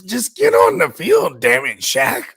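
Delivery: high, loud, exasperated plea; frustrated outburst. Real, not acted. Cohost venting about Shaq's injury, exasperated high plea. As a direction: vent at the injured star.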